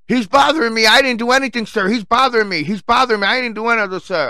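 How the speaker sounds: high-pitched whiny tattletale imitation; high, pleading mock complaint; mock screeching. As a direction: mimic a grown man whining to security. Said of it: "screeching at the top of his lungs".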